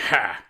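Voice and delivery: loud mocking "Ha"; a single gloating bellow; bellowed laugh. A narrator's reading. Rhino gloats over fallen Spider-Man, bellowing. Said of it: "Rhino bellowed".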